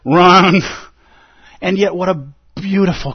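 loud, drawn-out "wrong"; emphatic rebuke. Acted. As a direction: cut the question down like a sword stroke. Zen master's cutting rebuke, acted.

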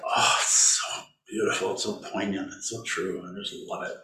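softening to a hushed, moved murmur; quiet awe at a beautiful poem. Real, not acted. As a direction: let the voice fall away, moved by the poem. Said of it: "I don't know why I'm smiling".

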